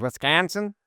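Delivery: Wisconsin said with a Midwest twang, pitched high; Midwest accent. Retold or imitated. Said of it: "your Midwest accent comes out so much when you say Wisconsin".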